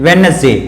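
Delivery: वेडनेसडे said as "wen-es-day"; वेडनेसडे with no d before the n; silent D. Shown as a demonstration. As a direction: drop the first d in वेडनेसडे. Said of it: "उच्चारण नहीं होगा".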